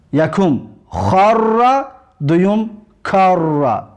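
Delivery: خر then کر, drawn out very slowly; slow contrast. Shown as a demonstration. Teacher repeating both words slowly for students. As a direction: slowly set rasped خ against hard ک.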